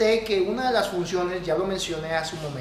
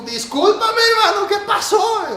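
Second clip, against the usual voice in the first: laughing, high, loud shout of shock; shocked cry; 14 semitones higher, 8 dB louder, slower than usual. Retold or imitated. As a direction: blurt it out after a salty gulp. Choking on salty coffee, retold.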